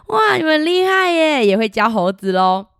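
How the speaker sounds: put-on big loud wow for the kids; excited praise. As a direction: cheer the children on. (兴奋地夸奖)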